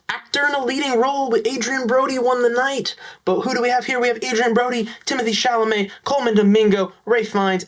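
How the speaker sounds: high-pitched, hurried list reading; jokingly sped up. Pretend. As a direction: rattle it off high and a touch too fast. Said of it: "try to talk point five percent faster now".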